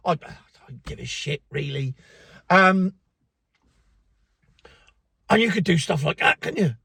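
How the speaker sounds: ordinary talk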